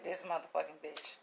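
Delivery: imitated officer cursing low and soft; muttered insult. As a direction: mutter it under your breath, as the officer. Voicing seething officer behind her.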